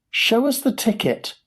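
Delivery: high-pitched demand from an onlooker; high crowd call. A narrator's reading. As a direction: call out high, without full volume. Crowd clamours to see ticket.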